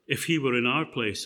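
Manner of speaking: ordinary talk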